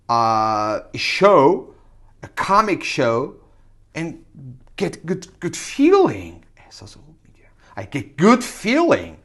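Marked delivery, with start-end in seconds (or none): 3.9-7.5 unvoiced repeated whisper. Shown as a demonstration. Learner whispering the phrase to practise it, whisper. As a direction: whisper the phrase back to yourself.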